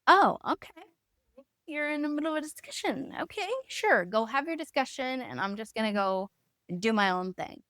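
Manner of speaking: high, huffy mock okay, sarcastic and hurt; offended sarcasm. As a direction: toss it off high and sarcastic, stung. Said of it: "'Cause I was already triggered".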